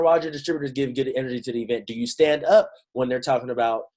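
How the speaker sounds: ordinary talk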